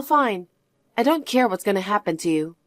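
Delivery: usual speaking voice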